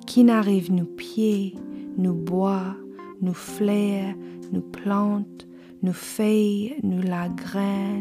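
slow, hushed ritual chant; incantation. Acted. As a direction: intone it like an ancient invocation. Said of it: "the poem itself is read almost like an incantation".

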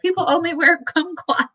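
high, mock official decree; hammed-up announcement; mock governor order. Cuomo announcing kumquat rule, mock official. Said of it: "Cuomo is gonna be like".

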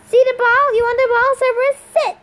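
high, loud dog-trainer call; loud, high-pitched ordering about; shouted command. A narrator's reading. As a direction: shout to get a dog's attention. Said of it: "She shouted".

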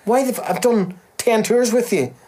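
high-pitched, incredulous protest; mock outrage. Acted argument with the tour promoter.

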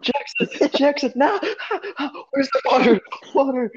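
high, frantic retold cries; panicked high-pitched yelps, half laughing; mock panic. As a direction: cry out in exaggerated alarm. Acted panic in retold scene.

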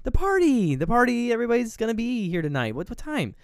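high, loud, put-on mother voice easing off; mock insistent mom. Mother presses son about party.

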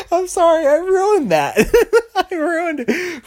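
high, squealing through laughter; laughing apology. Real, not acted. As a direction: apologize while helplessly cracking up. Cracks up, botching his bit.

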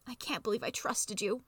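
quiet, defeated lament; groaning. A narrator's reading. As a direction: groan it out, wounded and betrayed. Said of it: "he'll groan".

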